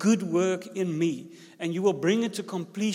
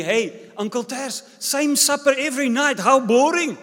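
mock kids' taunt, higher and louder; kids shouting; 6 semitones higher, 7 dB louder than usual. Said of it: "they were shouting at me".

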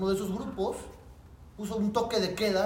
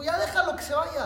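commanding officer; 9 semitones higher, 4 dB louder than usual. Retold or imitated. Officer orders release.

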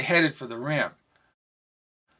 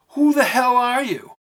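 higher, louder angry demand; 9 semitones higher, 5 dB louder than usual. Acted. Gifford demanding of Jamel who she is, angry and loud. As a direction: snap it at the stranger.

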